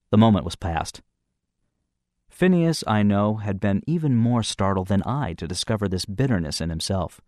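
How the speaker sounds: usual speaking voice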